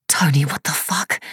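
sharp stunned whisper; whispered swearing in shock. A narrator's reading. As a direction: hiss it in disbelief under your breath. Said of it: "she whispered".

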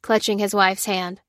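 usual speaking voice

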